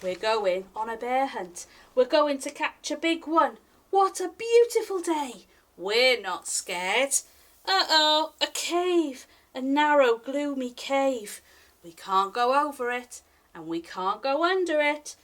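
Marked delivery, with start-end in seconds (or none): none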